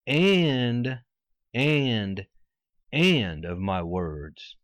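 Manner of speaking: AND repeated, drawn out, each set apart; stress on AND. Shown as a demonstration. Preacher underlining a word of the verse for listeners, emphatic "and". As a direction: lean hard and long on "and", pausing after it. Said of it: "I want you to underline the word "and".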